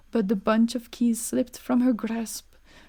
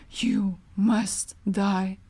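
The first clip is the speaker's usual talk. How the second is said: very slow, heavy menace; death sentence; much slower than usual. Acted. Bluebeard sentencing his wife to death, very slow. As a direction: drag out the doom.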